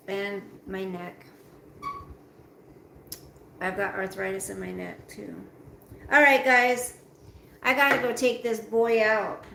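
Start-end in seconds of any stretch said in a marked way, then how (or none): none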